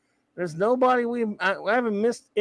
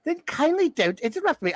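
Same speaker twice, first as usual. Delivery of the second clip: higher, lightly voiced prim computer; a haughty machine, a bit higher; Orac impression; 7 semitones higher than usual. Orac scolding the crew, in a lightly voiced higher pitch. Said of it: "I don't think I do a very good Orac".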